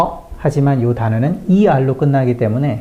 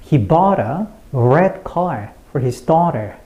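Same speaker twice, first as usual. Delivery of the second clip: slow beats on "bought", "red car", "daughter"; content-word stress; much slower than usual. Shown as a demonstration. Teacher repeats for learners, slow stress on "bought", "red car", "daughter". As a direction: lean on "bought", "red car", "daughter", let "He", "a", "for his" go light. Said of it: "다시 한번 해볼까요?".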